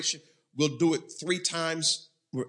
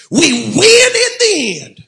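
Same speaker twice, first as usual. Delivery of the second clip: very high, very loud, drawn-out shout; roaring preacher's victory cry; emphatic declaration; 16 dB louder, slower than usual. Shown as a demonstration. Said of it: "One final time with emphasis".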